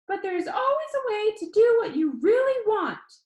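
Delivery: high, earnest, near tears; earnest host. A narrator's reading. Danny encourages his viewers, high and earnest. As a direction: push through a catch in the voice. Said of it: "he said".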